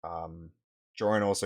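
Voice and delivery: usual speaking voice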